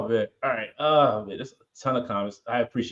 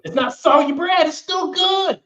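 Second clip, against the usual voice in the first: high, loud protest; 5 dB louder, slower than usual. Real, not acted. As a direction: insist it's good, voice climbing. Arguing over bread.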